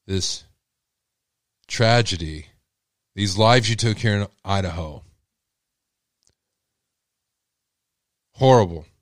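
firm, contemptuous rebuke; addressing the murderer. Real, not acted. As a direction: tell him off coldly and deliberately. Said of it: "I want to say something to the murderer".

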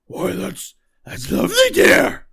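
very high, loud cooing crone voice; doting witch mother. Acted. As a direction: coo like a delighted old hag. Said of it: "a voice from the hut going".

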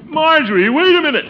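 loud, high call after someone walking away; calling after her. Acted. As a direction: raise your voice to stop her leaving. Uncle calls after leaving niece.

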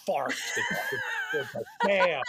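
high laughing shout of fake outrage; laughing mock outrage.

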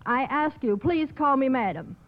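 normal talking voice

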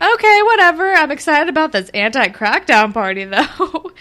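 put-on high, loud, dismissive girl voice; mocking Shantae. Shantae shrugging off Jenna, high and loud. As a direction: brush her off and change the subject. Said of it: "Shantae's like".

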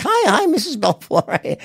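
high, drawn-out greeting in a put-on voice; imitated stripper fiancée. New wife Blaze greets his mother on the phone, high put-on voice. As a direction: mimic her greeting the mother, high and slow. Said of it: "And she's like".

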